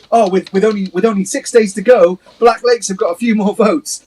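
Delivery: cheesy host of the song contest; Eurovision host. Acted. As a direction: announce the voting like a televised contest. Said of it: "I feel a little bit like I'm at Eurovision".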